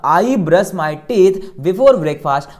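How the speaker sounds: slow, loud teacher's model sentence; drawn-out classroom drill; repeat-after-me model. Shown as a demonstration. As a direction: say it slowly and strongly for learners to join in. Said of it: "मेरे साथ बोलना".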